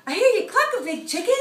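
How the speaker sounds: high, loud little chick voice; a small chick answering his mom. Acted. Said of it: "Little Chicken says".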